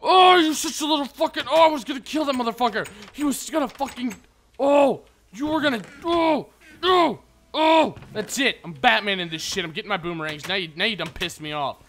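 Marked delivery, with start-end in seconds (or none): 0.0-8.0 furious shouting. Real, not acted. Angry outburst at mob.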